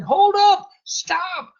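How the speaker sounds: imitating the lady calling him back. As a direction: shout after a departing truck. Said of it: "running down these stairs going".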